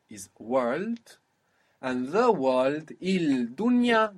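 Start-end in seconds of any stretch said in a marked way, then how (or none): 3.0-4.1 il dunya with a clear l, pitched up; il dunya said with the l sounded, lifted high; unassimilated article. Shown as a demonstration. Teacher shows the form before assimilation, high and loud.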